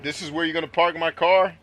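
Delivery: loud, outraged question; indignant. Real, not acted. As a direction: call out the bad parking. Indignant at a parked car.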